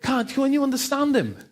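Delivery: high, mocking schoolkid voice asking what he said; puzzled kids mocking. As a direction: imitate the local kids who can't follow the newcomer's accent. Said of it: "people are going".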